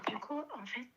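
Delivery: ordinary talk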